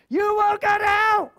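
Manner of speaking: high, loud ranting preacher voice; a loud, high hammed-up street preacher warning; mock hellfire yell. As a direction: shout it like a street-corner doomsayer. Mocks a street-corner preacher.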